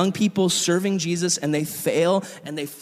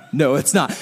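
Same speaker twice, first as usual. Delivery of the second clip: bitter laugh through the words; 3 semitones lower, 4 dB louder than usual. Retold or imitated. Churro lady answers him, laughing bitterly. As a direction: laugh it off, a bit louder. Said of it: "she's like".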